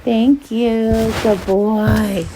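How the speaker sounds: plain reading voice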